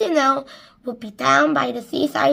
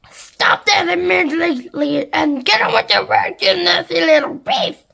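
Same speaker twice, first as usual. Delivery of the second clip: loud, higher scolding command; angry order; 6 semitones higher, 9 dB louder than usual. A narrator's reading. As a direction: scold the boy harshly. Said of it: "and Sponge ordered".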